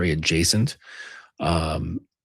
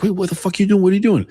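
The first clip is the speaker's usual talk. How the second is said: high, loud burst of mock alarm; alarmed passenger; 11 semitones higher, 5 dB louder, faster than usual. Passenger to driver who let go of wheel, alarmed. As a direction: protest urgently, imitating a panicked passenger.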